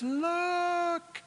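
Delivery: high, held calling note; mock herald's call, long and high without force; crying out. Prophet announcing Messiah in the wilderness, high drawn-out call. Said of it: "voice of one crying in the wilderness".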